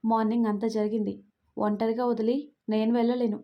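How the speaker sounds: ordinary delivery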